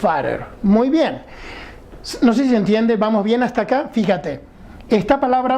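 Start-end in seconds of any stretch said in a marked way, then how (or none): 0.0-0.4 "fatter" again with a tapped r sound, short and low; American fatter. Shown as a demonstration. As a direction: repeat "fatter" as "farer". ("fatter" con r suave, repetido para el alumno)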